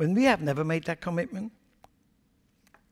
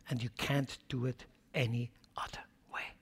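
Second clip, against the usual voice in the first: whisper; 3 semitones lower, 7 dB quieter than usual. Acted. Sermon, dropping to a whisper.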